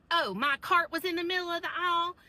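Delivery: mock indignation. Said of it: "And she was like".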